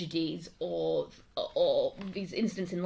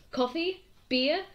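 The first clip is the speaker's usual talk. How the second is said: COFFEE and BEER each lifted high as separate questions; questioning offers; 9 semitones higher, slower than usual. Acted. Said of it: "coffee with a question mark".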